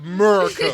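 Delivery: America squashed into a loud "Murica"; comic pronunciation. Pretend. Dad joins the America joke, loud "Murica".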